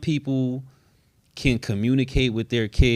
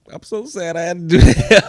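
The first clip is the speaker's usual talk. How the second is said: mock-sad whine, higher and louder, breaking into a laugh; 6 semitones higher, 9 dB louder than usual. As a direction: ham up the sorrow while giggling. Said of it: "and I was like".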